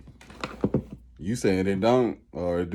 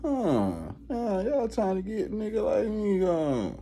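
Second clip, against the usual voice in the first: much higher, knowing suspicious taunt; sensing the system is against you, high and wary; 11 semitones higher, 3 dB quieter than usual. Retold or imitated. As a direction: catch them plotting against you. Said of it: "always a little".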